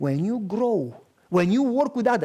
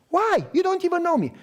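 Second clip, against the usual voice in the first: much higher, hurt and indignant; high indignant protest; 10 semitones higher than usual. Retold or imitated. Woman protesting to the biker who is upset with her, high and indignant.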